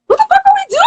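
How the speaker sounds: high, fast, stunned blurt; a rookie cop suddenly seeing it's wrong; shocked outburst. Retold or imitated. To himself, a rookie cop realising the arrest is wrong, shocked. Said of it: "he was just like".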